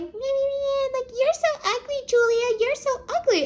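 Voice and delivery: high, whiny schoolyard taunt; mock her like a bratty six-year-old; taunting kids. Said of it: "they're like".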